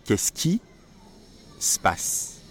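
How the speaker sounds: qu'est-ce qui se passe as 'kes ki s'pass'; slow model, split in two; clipped everyday French; natural spoken form. Shown as a demonstration. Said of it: "The way that you should say this is".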